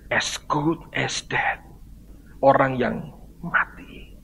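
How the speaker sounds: very slow, soft near-whisper; slow, whispered weight; hushed solemn emphasis. Real, not acted. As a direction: drop to a hush and space out each word. Solemn climax of the sermon.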